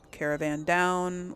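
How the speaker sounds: usual speaking voice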